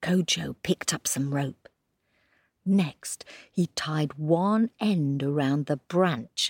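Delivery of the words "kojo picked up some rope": hushed. A narrator's reading. Sneaking scene narrated hushed.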